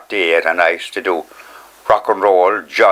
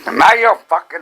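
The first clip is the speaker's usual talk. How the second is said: mock cursing; 8 semitones higher than usual. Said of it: "she started cussing and swearing".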